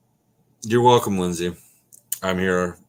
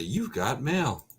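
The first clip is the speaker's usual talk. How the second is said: lightly mimicked computer greeting, higher and slow; drawn-out, higher, softly imitated alert; AOL mail alert; 6 semitones higher, 4 dB quieter, slower than usual. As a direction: stretch it out like an email announcement.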